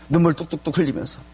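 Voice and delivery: normal talking voice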